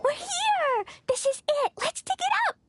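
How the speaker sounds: high, eager exclamations; bursting with childish excitement; excited. Acted. Child excitedly arriving at dig.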